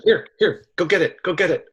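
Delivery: high coaxing call to a dog; high-pitched here boy call. Retold or imitated. Like you would with a dog.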